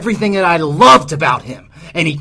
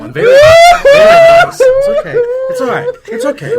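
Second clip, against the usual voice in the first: hammed-up sobbing, much higher and louder; mock crying; 11 dB louder than usual. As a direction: fake weeping to mock his speech. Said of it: "that's very emotional".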